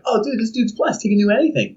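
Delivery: high, impressed dude voice, lightly mocking; mock admiring bystander. Onlookers praising the blessed man, mock awe. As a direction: gush about the lucky guy. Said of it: "you're like".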